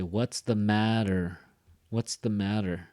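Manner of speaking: "matter" stressed, then the pitch drops; falling question intonation. Shown as a demonstration. Teacher showing that questions don't go up, falling tune. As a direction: ask it with "matter" falling at the end. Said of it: "I don't go up".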